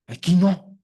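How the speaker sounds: a loud, pressing not here; sharp warning. Retold or imitated. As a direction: cut him off firmly. Advertencia tajante al ciego. (advertencia fuerte)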